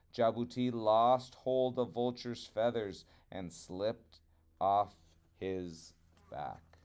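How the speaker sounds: ordinary delivery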